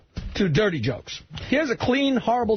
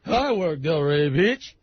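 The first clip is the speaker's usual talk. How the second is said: [name] impression; 4 semitones lower, slower than usual. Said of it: "[name] is on stage".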